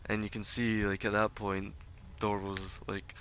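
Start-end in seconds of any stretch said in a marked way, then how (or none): none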